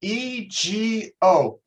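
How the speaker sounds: E, G, O spelled out slowly, each letter apart; a teacher spelling E G O slowly for the class. Shown as a demonstration. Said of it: "is three letters".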